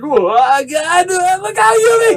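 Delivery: panicked scream. Acted. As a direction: scream a warning as the hammer flies. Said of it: "And he screams".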